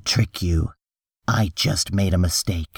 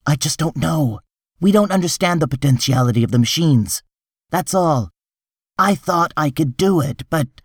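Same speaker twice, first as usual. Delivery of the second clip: higher-pitched, harried excuses; flustered defensiveness; 7 semitones higher than usual. Acted. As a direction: plead your case under pressure. Hartz defends his failure under attack.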